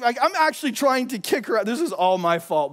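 ordinary talk, nothing marked

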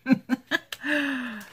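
high little giggle; real, short laugh; amused giggle. Real, not acted. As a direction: giggle at your own silliness. Laughing at own munching noises.

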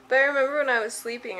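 usual speaking voice

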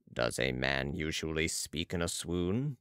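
low, slow, soft character voice; Balsamo questioning. A narrator's reading. Balsamo challenges Marat's verdict, low slow question. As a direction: question him from deep down, unhurried.

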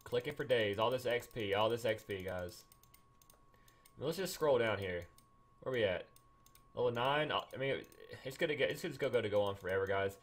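usual speaking voice

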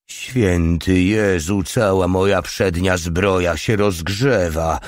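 usual speaking voice

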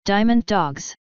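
growling. A narrator's reading. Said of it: "Rarity growled".

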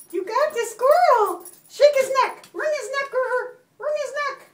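high singsong egging-on; gleeful urging. Real, not acted. Mommy praising River's squirrel catch, gleeful pet talk. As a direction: cheer a pet's catch in a high voice.

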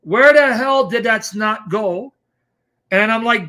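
ordinary talk

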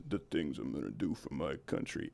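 very low, soft movie-hero rumble; Vin Diesel impression. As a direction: growl it like a brooding action star. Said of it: "Vin Diesel goes".

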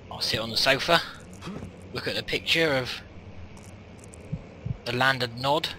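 ordinary delivery

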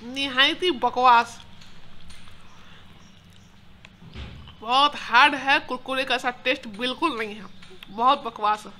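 words between bites; chewing while talking. Real, not acted. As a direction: mouth full of crunchy snack. Seen 2: Bites orange stick and chews visibly while speaking throughout.

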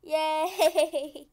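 loud, laughing cheer; gleeful cheer. Real, not acted. Player celebrating the carrot, gleeful laughing cheer.

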